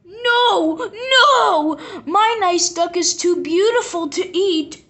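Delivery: put-on high pleading no, no from a child; a little boy begging to save his duck; high, pleading child's cry. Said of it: "cried the boy".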